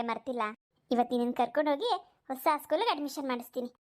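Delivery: normal talking voice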